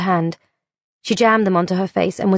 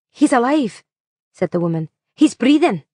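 higher, urgent news; urgent relief; 5 semitones higher, 4 dB louder than usual. A narrator's reading. The woman tells the driver the passenger breathes, urgent. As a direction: call it out, relieved.

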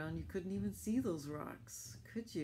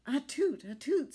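high, loud sneezing toot; squeaky tugboat sneeze; 10 semitones higher, 9 dB louder than usual. Acted. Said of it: "sneezed Alice".

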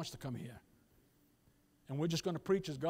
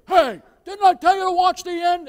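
high, very loud sideline bellow; imitated scolding shout; irate father yelling; 13 semitones higher, 19 dB louder than usual. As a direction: holler across the field like an angry dad. Retold father yelling across football field.

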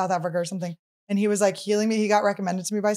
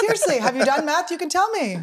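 high teasing voice; 8 semitones higher than usual. Acted. Teasing coaxing question.

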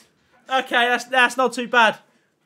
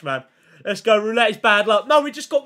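ordinary talk

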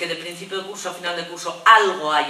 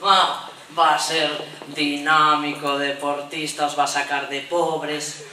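slow mock-proud boast, lower; proud macho boast; 5 semitones lower, slower than usual. Neighbour boasting about a baby boy, mock proud. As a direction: puff up proudly over the boy. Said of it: "Cambia el tono completamente".

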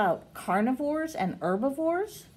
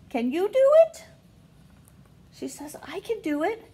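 storybook voice; reading to children; 5 semitones higher than usual. Acted. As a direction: read to the little ones, lifting each question. Reading picture book to kids.

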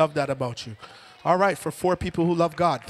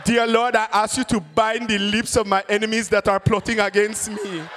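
put-on fervent Ghanaian-accented prayer; hammed-up, forceful Ghanaian preacher; Kwesi from Ghana; 3 semitones higher than usual. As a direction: implore the Lord in a Ghanaian accent. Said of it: "someone from Ghana pray".

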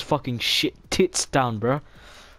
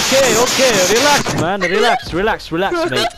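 loud, higher, telling them to relax; calming shout; 7 semitones higher, 6 dB louder, faster than usual. Real, not acted. Gamer shouting at noisy kids to relax.

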